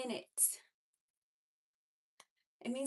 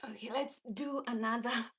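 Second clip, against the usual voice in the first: ordinary delivery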